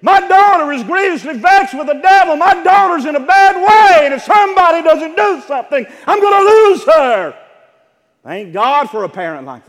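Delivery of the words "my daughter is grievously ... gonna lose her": high, loud, shouting plea for a sick daughter; desperate plea. Acted. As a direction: cry out to him as a desperate mother. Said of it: "fall down at a man's feet and say".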